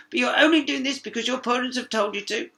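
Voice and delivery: hurt frog; sobbing frog. A narrator's reading. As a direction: complain through tears. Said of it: "sobbed the frog".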